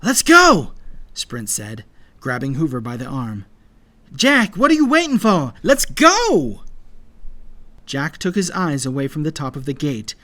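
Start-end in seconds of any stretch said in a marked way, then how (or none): 0.0-0.7 high, very loud urging; high urgent yell; urgent shout. A narrator's reading. Urging others to flee battle. 4.1-6.6 high, loud, impatient yell; shouting at a friend to move; urgent shout. A narrator's reading. Calling a friend to flee.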